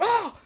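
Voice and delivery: high gasping oh on waking; startled gasp. Retold or imitated. Retelling waking up, a high startled gasp. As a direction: gasp awake, high and sharp.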